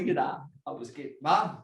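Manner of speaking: ordinary talk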